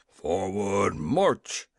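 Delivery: restrained, low military shout; majors' shout. A narrator's reading. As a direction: give the order low and firm. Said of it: "shouted the majors".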